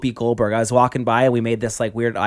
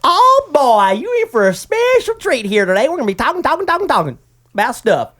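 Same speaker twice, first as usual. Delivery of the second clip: high, loud country bumpkin twang; exaggerated folksy country voice; folksy accent; 12 semitones higher, 5 dB louder than usual. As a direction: ham up a corny rural announcer. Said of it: "that accent".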